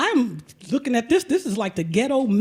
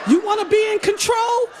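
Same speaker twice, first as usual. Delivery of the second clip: much higher, louder taunting question; mock challenge; 10 semitones higher, 5 dB louder than usual. Pedicurist challenging the client, loud taunt. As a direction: call her out for wanting control.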